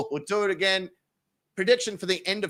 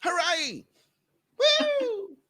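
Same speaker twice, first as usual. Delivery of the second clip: high whooping cheers; high mock celebration whoops; cheering; 11 semitones higher, much slower than usual. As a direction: cheer like a party crowd. Cheering to close show.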